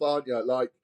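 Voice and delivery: usual speaking voice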